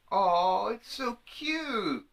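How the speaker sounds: high, slow cutesy coo; cooing over it. Pretend. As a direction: coo at it like a baby animal. Mock quote of woman cooing.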